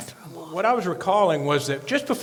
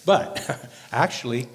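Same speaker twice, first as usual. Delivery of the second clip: normal talking voice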